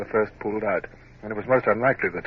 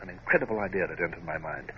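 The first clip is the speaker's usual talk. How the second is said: ordinary talk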